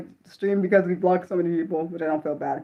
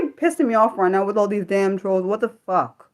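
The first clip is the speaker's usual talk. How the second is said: ordinary talk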